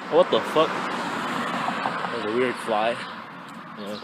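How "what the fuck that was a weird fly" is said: usual speaking voice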